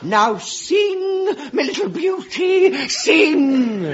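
hammed-up hypnotist ordering; hypnotist command. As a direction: coax the song out of her. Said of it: "hypnotist commands her to sing".

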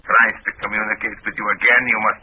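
commanding put-on Russian accent; best Russian accent. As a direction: give orders as a Russian agent, accent on. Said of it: "in my best Russian accent".

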